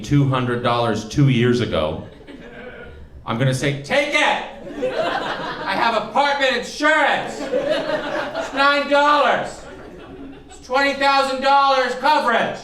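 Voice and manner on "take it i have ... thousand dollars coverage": high-pitched, hollered plea to a burglar; frantic surrender. Acted. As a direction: yell it high, dragging out the key words. Acted plea to an imagined burglar.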